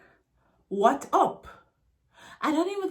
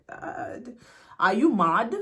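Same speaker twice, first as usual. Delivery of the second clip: normal talking voice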